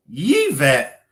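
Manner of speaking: "[name]" drawn out as Yee-vet; corrected pronunciation. Shown as a demonstration. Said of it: "My name is pronounced".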